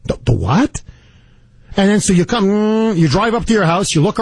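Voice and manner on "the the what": low, baffled double-take; a caught-off-guard what; stunned disbelief. Retold or imitated. Owner hearing his dog was shot, stunned and baffled.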